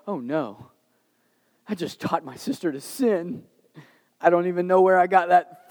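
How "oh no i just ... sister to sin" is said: quiet little gasp of guilt. Retold or imitated. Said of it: "And I'm like".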